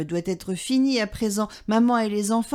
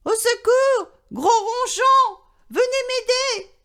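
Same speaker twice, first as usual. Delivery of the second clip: very high, loud cry for help; louder and louder; 14 semitones higher, 4 dB louder than usual. A narrator's reading. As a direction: shout for the neighbour. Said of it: "Puis de plus en plus fort".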